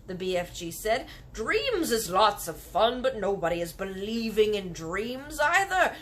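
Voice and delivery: the kindly giant lecturing on dreams. Acted. BFG voiced in story.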